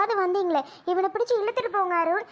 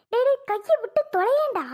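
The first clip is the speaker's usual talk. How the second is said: டேய் pitched higher and pushed out; fed-up order, higher on டேய்; higher exasperated demand; 5 semitones higher than usual. Acted. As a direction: snap at him to let go of her hand. She tells him to let go.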